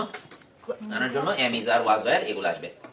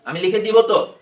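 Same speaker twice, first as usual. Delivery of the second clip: higher, louder teacherly retort; raised-voice insistence; 8 semitones higher, 10 dB louder, slower than usual. Real, not acted. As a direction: insist firmly over the student. Teacher checking an impatient student. (জোর গলায় শাসনের সুরে)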